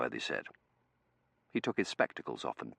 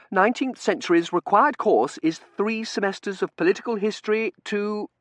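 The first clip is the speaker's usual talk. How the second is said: high, loud recital of the rules; officious Seraphim; 11 dB louder than usual. Acted. Seraphim citing required courses to Dunworthy, high officious protest. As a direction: insist on procedure, pitched high.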